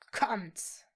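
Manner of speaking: short commanding hiss; cold hissed command. A narrator's reading. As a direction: hiss the order. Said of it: "zischte er".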